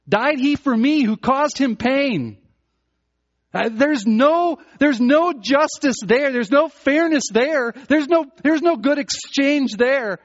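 high, loud and slow, full preaching force; fervent preaching. Acted. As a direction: preach it with everything.

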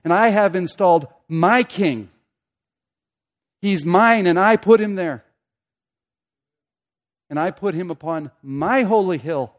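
loud, deliberate decree with weighty pauses; dreadful declaration. Acted. God declaring his chosen king to the nations, dreadful declaration. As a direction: hammer it out as an almighty pronouncement. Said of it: "here's the dreadful declaration".

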